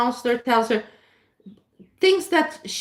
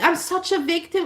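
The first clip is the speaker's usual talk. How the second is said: mock victim whine; 8 semitones higher than usual. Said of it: "and, uh, I'm like".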